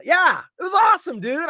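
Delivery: loud, high excited exclamation. Real, not acted. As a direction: burst out with pride. Proud exclamation.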